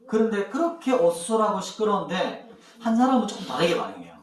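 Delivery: normal talking voice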